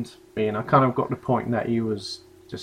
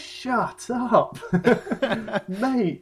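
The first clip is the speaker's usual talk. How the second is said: laughing mock retort; 5 semitones higher, slower than usual. Retelling his own reaction.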